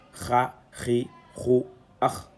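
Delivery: "kha, ri, hu, ah" repeated with a throaty kh in each; dotted-letter kha sound. Shown as a demonstration. Teacher repeating the dotted letter's series, throaty kh sound. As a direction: sound out "kha, ri, hu, ah" separately, with a rough kh. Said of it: "la même lettre avec un point au-dessus, donc ça devient".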